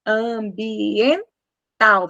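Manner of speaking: Ambiental said very slowly with the nose pinched; slow Ambiental through a held nose; nose held demo. Shown as a demonstration. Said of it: "Vamos prender o nariz".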